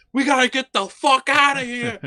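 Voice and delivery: loud, high panicked order; hammed-up escape cry; urgent mock yell. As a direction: shout to flee right now. Acts out urgent call to flee.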